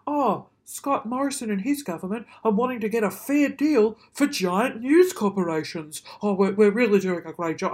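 loud, heaped-on sarcasm; mock the government's self-congratulation, loudly; scornful, emphatic mimicry; sarcastic mockery. Mocks the government's self-praise.

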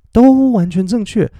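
high, loud and slow, driving the point home; emphatic. Real, not acted. Teacher insisting to students both are right, emphatic. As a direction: stretch it out with big emphasis.

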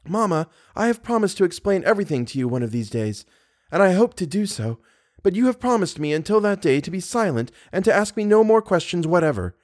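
pleading gently with her mother; young woman voice. A narrator's reading. Christine pleads with Mamma.

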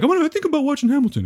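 high, fast, mocking voice; mocking friend. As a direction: tease him in a silly voice. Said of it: "It's weird for you to be like".

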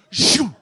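loud explosive mock sneeze; a big comic sneeze sound; imitated sneeze. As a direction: blast out a fake sneeze. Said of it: "sneezed all over me like this".

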